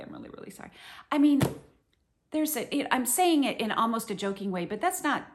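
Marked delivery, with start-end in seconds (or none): 0.0-0.7 whispered, much softer apology; a sheepish hushed sorry; mock-contrite whisper. Regretful apology to friends.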